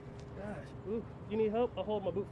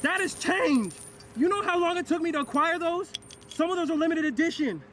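loud indignant protest; 5 semitones higher, 6 dB louder than usual. Real, not acted. Protesting the officer taking his quarters.

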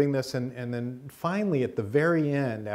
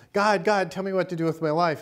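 mock pleading; 7 semitones higher, 3 dB louder than usual. [name] pleading to God again.

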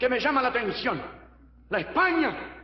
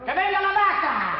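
calling out; 9 semitones higher, 4 dB louder than usual. Acted. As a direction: summon the cow into the ring. Llama a la vaca al ruedo. (grito llamando al ruedo)

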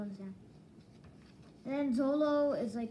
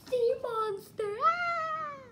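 surprised shout; 13 semitones higher, 6 dB louder, much slower than usual. Real, not acted. Seeing the sea monster.

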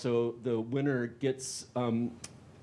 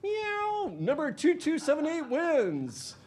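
high squeaky cat voice; slower than usual. Acted. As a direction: meow like a cartoon cat announcing the winner. Cat picks winning ticket.

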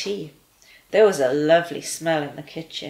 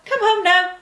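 much higher, loud call to the dog; 14 semitones higher, 7 dB louder than usual. A narrator's reading. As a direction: call her home across the fields. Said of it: "he called".

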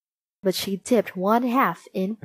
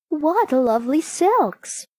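high, lingering admiration; delighted cry; 6 semitones higher than usual. A narrator's reading. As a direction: exclaim slowly, charmed by the wares.

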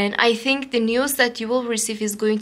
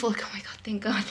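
whispered oh my God of relief; relieved whisper; 2 semitones lower, 9 dB quieter, faster than usual. Real, not acted. Reader whispering her relief to the viewer, hushed. Relieved aside during reading.

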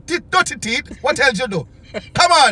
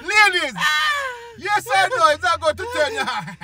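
calling out; 8 semitones higher than usual. Real, not acted. Calling out to the ladies.

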